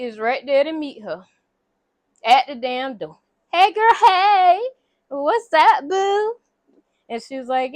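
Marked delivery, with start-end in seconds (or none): 3.5-6.4 high, loud mock-gushing hello; effusive greeting. Woman meeting friend at the door, high effusive greeting. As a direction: greet her big and high, drawn out.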